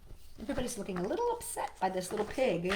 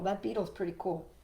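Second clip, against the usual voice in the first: usual speaking voice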